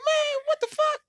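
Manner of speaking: high, strained falsetto; his own exasperation, hammed up; mock exasperation. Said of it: "So I'm like".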